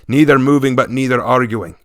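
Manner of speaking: usual speaking voice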